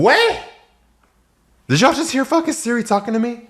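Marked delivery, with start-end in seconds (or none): 0.0-0.4 high startled what. Real, not acted. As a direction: jump at the sudden voice. Startled by Siri.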